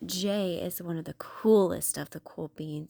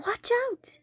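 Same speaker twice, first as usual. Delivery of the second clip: excited warning shout. A narrator's reading. Story opening, warning call.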